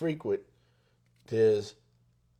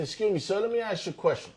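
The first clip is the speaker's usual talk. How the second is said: lifted, much higher polite voice; admiring tone; 9 semitones higher, faster than usual. Retold or imitated. Stopping a car owner, high admiring tone. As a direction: ask sweetly, high and admiring. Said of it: "the tone that I'm using is one of admiration".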